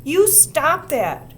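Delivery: slow, high, loud scolding; angry. A narrator's reading. Susan stamping her foot at the teasers, angry. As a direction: stamp it out slowly. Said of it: "she said angrily".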